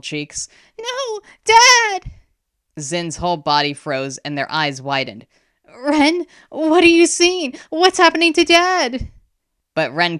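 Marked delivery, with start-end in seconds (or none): 0.8-2.1 very high, loud pleading cry; anguished shriek. A narrator's reading. Girl crying for her father, anguished shriek. 5.8-9.1 high, loud, frantic questions; panicked questioning. A narrator's reading. Boy asking sister about Dad, panicked. Brother panics over the vision.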